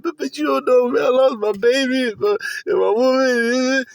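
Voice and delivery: high-pitched imitation of a distraught man; mock pleading. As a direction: stammer and plead in a mocking high voice. Mocking a man pleading his losses.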